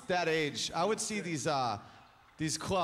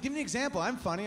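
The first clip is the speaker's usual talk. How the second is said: put-on higher, insistent girlfriend voice; indignant girlfriend; 6 semitones higher, faster than usual. Girlfriend insisting she's funny, pitched up and pushy. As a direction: demand proof, pitched up. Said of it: "She's like".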